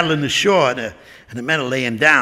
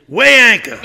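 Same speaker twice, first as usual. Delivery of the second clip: loud clipped command; captain's order; 7 semitones higher, 7 dB louder than usual. Acted. Chris ordering the first mate to set sail, loudly. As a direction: bark the order to the crew.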